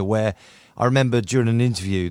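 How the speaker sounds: ordinary talk, nothing marked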